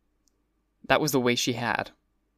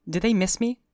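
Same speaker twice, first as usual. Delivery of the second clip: high, thrilled Daisy voice; much higher, eager young woman; ecstatic cry; 9 semitones higher than usual. A narrator's reading. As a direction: ask it delighted, voice soaring. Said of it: "she cried ecstatically".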